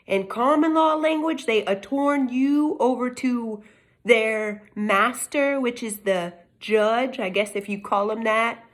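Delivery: ordinary talk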